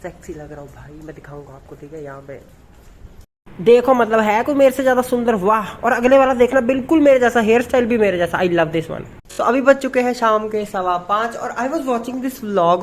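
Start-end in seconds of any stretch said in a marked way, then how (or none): 3.5-9.1 excited. Real, not acted. Shows off photos, thrilled.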